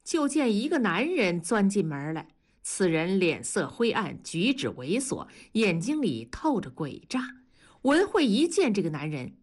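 plain reading voice

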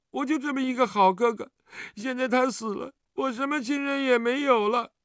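put-on high, plaintive child voice building toward tears; aggrieved child. A narrator's reading. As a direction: plead and grieve like a wronged little kid. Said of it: "接着委委屈屈地道".

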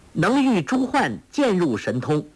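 put-on high girlish intoning; girl reciting a translation. Girl making up the next translated line, high and recited. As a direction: start very high, recite it like verse.

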